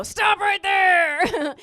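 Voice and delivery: loud, high, drawn-out halt; emphatic interruption. Acted. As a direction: shout to cut the questions off. Cuts off son's questions.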